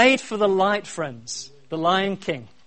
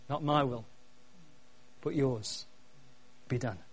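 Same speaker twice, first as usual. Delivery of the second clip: soft, lower, with long pauses; quiet surrender; 3 semitones lower, 12 dB quieter than usual. Retold or imitated. Preacher voicing Jesus praying in the garden, quietly surrendered. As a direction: let each phrase sink in submission.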